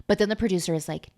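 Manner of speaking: ordinary talk, nothing marked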